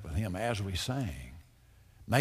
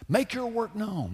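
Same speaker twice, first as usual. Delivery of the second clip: high, slow, louder pleading prayer; fervent plea; 7 semitones higher, 3 dB louder, slower than usual. Acted. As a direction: cry out to God, drawing each word out. Preacher pleading prayer aloud.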